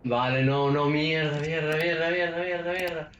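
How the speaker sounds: panicked curses climbing in pitch; cursing under breath. Real, not acted. Jumpscare panic in horror game.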